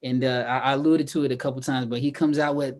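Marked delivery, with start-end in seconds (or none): none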